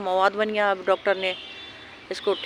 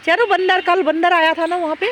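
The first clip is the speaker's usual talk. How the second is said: excited pet talk; 9 semitones higher, 4 dB louder than usual. Real, not acted. Owner talking excitedly to dog.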